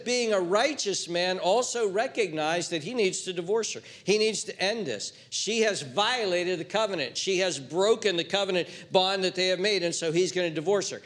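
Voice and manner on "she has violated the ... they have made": usual speaking voice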